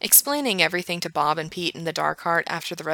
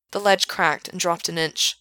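ordinary delivery, nothing marked

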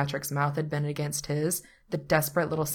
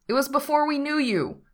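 higher, defensive protest; 10 semitones higher, 3 dB louder than usual. A narrator's reading. Pete defends himself to his attorney, protesting. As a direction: push back in your own defence. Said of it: "Pete protests".

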